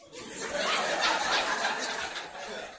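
long breathy laugh; a drawn-out unvoiced chuckle. Real, not acted. Preacher laughs at story.

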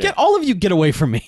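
high-pitched mock yell, easing off; retold yell. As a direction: snap at a crowd, acted out. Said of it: "Brick yells at him too".

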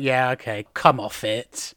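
mild scoff, unconvinced; disbelieving. Shown as a demonstration. Answering an unlikely claim, sceptical. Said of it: "when you want to express disbelief".

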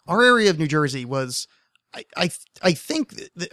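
usual speaking voice

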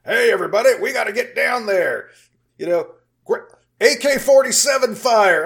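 mock a bland movie soldier rallying the squad; generic stock soldier voice. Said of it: "some of these guys sound like they could be, uh, just generic stock voices".

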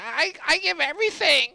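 very high whimper; imitating Sheik crying. Sheik pleading about his effort, high and teary. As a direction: sob it out high and pitiful. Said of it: "He's like".

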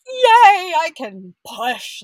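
very high, loud crying yay, then slow; tearful yay. Retold or imitated. Relief at finally being able to push, high crying yay. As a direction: cry out with relieved joy.

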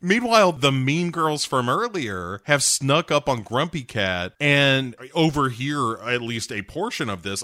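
normal talking voice

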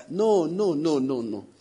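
usual speaking voice

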